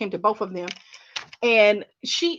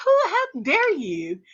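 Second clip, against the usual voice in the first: mock indignation; 6 semitones higher, slower than usual. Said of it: "I was so mad when she said that".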